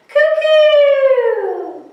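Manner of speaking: very high, loud cuckoo call falling away. Acted. Teacher playing the cuckoo bird for the kids, high and loud. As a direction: pop out like the clock bird. Cuckoo clock striking in song.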